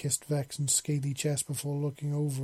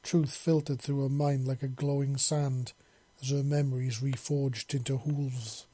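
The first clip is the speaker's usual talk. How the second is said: ordinary delivery, nothing marked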